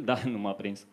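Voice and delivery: ordinary delivery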